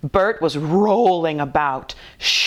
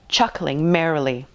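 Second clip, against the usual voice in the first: usual speaking voice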